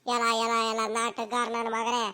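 slow mock scolding. Grandma catches the young man faking, slow low scolding. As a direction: drag it out, unimpressed by the act. Grandma sees through his act.

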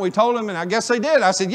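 usual speaking voice

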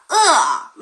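으 starting high and falling sharply into 어; fourth tone. Shown as a demonstration. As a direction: Mandarin fourth tone on 으. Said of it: "배고프다가 사성이에요".

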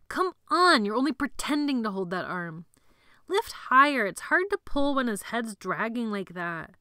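high loud urging; strained yelling. Acted. Boy urging partner carrying corpse, yelling. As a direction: yell at a slacking partner. Boys yelling while hauling bodies.

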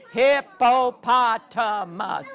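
ordinary talk

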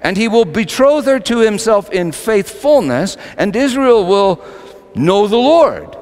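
high, loud, rising preaching climax; preaching at full force. Acted. Preacher building to Hosea's promise, full force.

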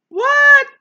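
a very high, loud, startled "What?"; shocked what. Real, not acted. Teacher shocked that people spoke against God, loud high disbelief. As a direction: react as if you cannot believe it.

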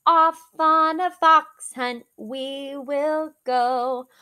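plain reading voice

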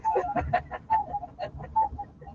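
high-pitched laugh; amused laugh, way up high. Real, not acted. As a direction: laugh high at your own act. Laughs at his own acting.

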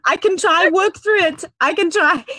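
usual speaking voice

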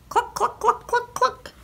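very high, short clucks; chicken clucks. A narrator's reading. Chicken helping wake the farmer, clucking. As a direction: cluck rhythmically like a hen. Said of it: "When the chicken said".

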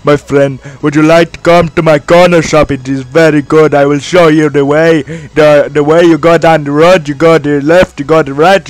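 hammed-up impression of Din selling his shop; Din's accent. Vlogger imitating how his buddy Din speaks, hammed-up accent. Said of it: "that is what my buddy Din speaks like".

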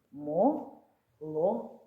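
"Мо-ло-ко" slow, three separate syllables. Shown as a demonstration. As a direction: tap out "Мо-ло-ко" slowly. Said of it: "складається з трьох складів". (повільно по складах)

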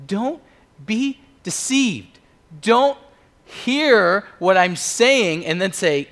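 loud, high, slow and punched out; emphatic warning. Acted. Paul warning the church, loud and emphatic. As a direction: hammer the warning word by word.

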